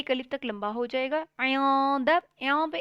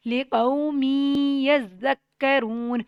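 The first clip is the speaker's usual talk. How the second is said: slow, louder tajweed chant; slow Quran recitation; 7 dB louder, slower than usual. Shown as a demonstration. Teacher reciting the verse aloud for the class. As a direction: recite each word slowly and fully.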